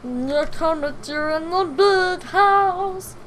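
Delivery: slow Russian caricature rising high; Mickey-Russian mix. Shown as a demonstration. Imitating the movie character, slow muddled Mickey-Russian voice. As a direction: start near normal, climb into slow squeaky Russian.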